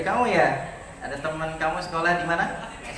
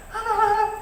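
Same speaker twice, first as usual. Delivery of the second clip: wife's excited cry; slower than usual. Retold or imitated. Principal imitates his wife announcing the result, high excited laughing cry. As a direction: squeal the good news with joy.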